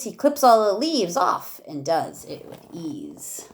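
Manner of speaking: lively rhyming read-aloud for a class; reading to children. Acted. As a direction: read each verse with bounce for the kids. Teacher reads rhyming picture book aloud.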